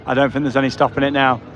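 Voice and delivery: ordinary talk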